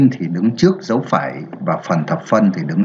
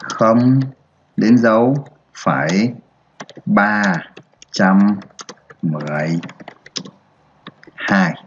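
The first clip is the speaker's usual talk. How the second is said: word by word, long pauses between; spaced-out reading for pupils to follow; slow dictation. Shown as a demonstration. Teacher models reading the decimal to the class, slow and spaced. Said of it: "Đọc là".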